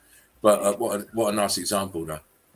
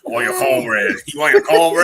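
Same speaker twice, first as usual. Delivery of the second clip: louder playful yell; loud joking shout; 4 semitones higher, 9 dB louder than usual. Pretend. Teasing friend, loud playful yell. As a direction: shout the joke, higher at the end.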